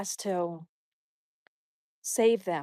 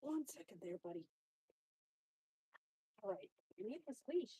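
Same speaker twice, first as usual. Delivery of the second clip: very soft murmur to the dog; much softer, quietly reassuring the dog; hushed to dog; 2 semitones lower, 20 dB quieter than usual. Real, not acted.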